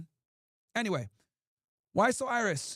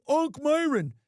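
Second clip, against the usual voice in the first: mocking a chat heckler's taunt; 6 semitones higher, 5 dB louder, much slower than usual. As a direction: sneer it back at the haters in a put-on voice.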